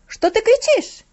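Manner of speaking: enraged woman shouting; furious shout. A narrator's reading. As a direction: snap back, raging. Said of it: "закричала разъярённая женщина".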